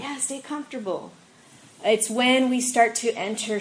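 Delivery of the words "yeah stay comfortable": tempting voice. Retold or imitated.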